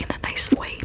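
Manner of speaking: ordinary delivery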